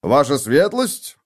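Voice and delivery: slow, drawn-out sneering courtesy; bow and greet them with scorn; a young guard mocking arrested fake nobles. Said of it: "насмешливо поприветствовал он гостей".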